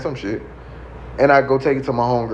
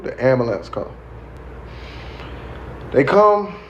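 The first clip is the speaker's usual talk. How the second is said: ordinary talk, nothing marked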